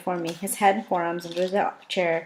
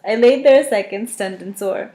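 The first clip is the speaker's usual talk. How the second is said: ordinary delivery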